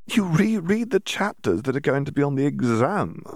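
brushing off her shock; dismissive. A narrator's reading. Draco answers dismissively.

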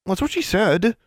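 high-pitched, defensive youngster. A narrator's reading. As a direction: protest it high, defending yourself. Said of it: "William said defensively".

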